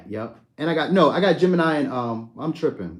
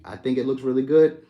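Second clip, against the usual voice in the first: usual speaking voice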